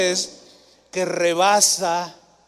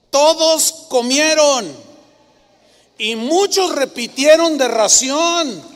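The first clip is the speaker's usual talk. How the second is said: slow, loud and higher, every word pressed; emphatic preaching; 7 semitones higher, 8 dB louder than usual. Real, not acted. Pastor booming the miracle to his church, slow and loud. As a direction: hammer home the miracle to the congregation.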